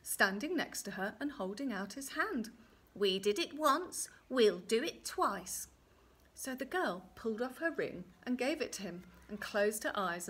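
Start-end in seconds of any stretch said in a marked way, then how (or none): 2.9-5.7 deliberate, loud little-man voice; little man's bargain. Acted. Little man bargains with the girl, slow and emphatic. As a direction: punch each count, drawn out at the end.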